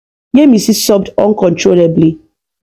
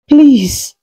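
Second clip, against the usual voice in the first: begging; 6 semitones higher than usual. A narrator's reading. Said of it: "she begged".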